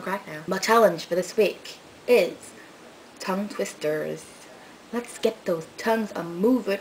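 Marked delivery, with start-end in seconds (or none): none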